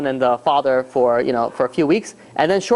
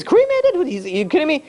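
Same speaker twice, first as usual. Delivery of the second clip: very high-pitched shocked cry; mock scream of outrage; retold scream; 11 semitones higher than usual. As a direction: screech the first word in disbelief, then ease down. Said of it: "the guy screams".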